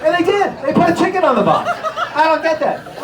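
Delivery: high, loud, incredulous; laughing disbelief. Acted. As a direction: exclaim it in amazement.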